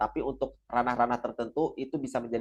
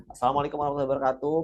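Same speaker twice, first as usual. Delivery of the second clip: fast rushed opening greeting; 3 semitones higher, much faster than usual. Shown as a demonstration. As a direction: gabble the salam like a nervous presenter. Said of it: "Misalkan ada yang presentasi". (salam yang diucapkan terburu-buru)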